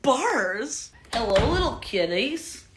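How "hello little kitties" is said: a touch of cartoon villain greeting. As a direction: greet them like a hammed-up villain.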